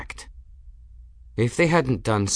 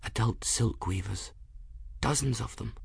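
hushed whisper; 2 semitones higher, 9 dB quieter than usual. A narrator's reading. Bridget warns Folly of silk weavers, whispering into her ear. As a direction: murmur it close to a listener's ear. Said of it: "whispered into her ear".

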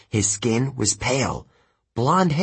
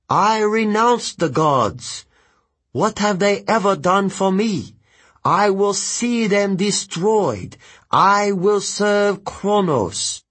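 high, slow, ringing vow; defiant oath; 8 semitones higher, 3 dB louder, slower than usual. Acted. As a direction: declare your betrayal word by word. Ethan swears allegiance to Kronos.

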